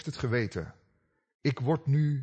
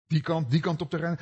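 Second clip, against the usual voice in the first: normal talking voice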